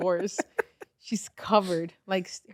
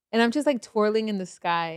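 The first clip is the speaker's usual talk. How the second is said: ordinary delivery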